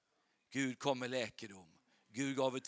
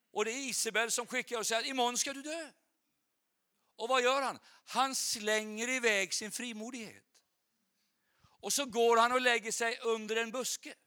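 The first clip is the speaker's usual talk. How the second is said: high, raised-voice sermon storytelling; dramatic preaching; 9 semitones higher, 8 dB louder than usual. Real, not acted. Preacher tells of Jezebel's threat and Elijah's flight, dramatic preaching. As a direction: hurl the story out at the congregation.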